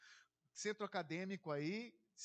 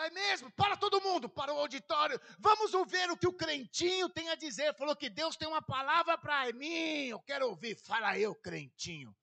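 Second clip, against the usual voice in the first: louder, taunting announcement to the room; a bully showing off to everyone, a bit louder; mocking bully; 4 semitones higher, 8 dB louder than usual. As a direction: jeer at him for the crowd. Bully mocking in front of crowd.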